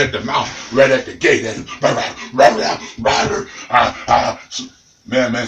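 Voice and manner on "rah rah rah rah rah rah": growling bark. Retold or imitated. Dog growling at gate.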